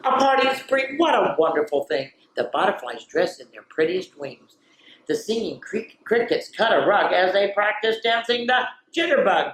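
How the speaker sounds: bright, lively storybook voice; excited picture-book reading; reading to children. Acted. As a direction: read it like a party is coming.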